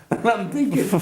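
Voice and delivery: ordinary delivery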